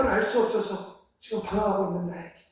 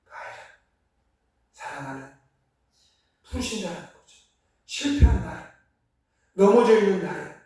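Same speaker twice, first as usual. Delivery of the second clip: ordinary talk, nothing marked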